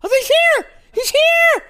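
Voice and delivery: very high, loud fan scream; starstruck scream. Retold or imitated. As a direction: shriek it out, overcome with excitement. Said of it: "I screamed. I was like".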